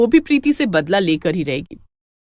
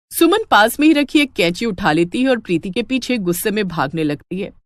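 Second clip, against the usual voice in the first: ordinary delivery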